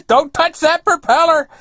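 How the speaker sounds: high, loud warning holler; imitating an alarmed old fellow yelling; retold yell. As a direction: shout it over to someone far away. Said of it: "he's yelling over at me".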